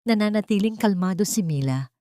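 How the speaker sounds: ordinary delivery, nothing marked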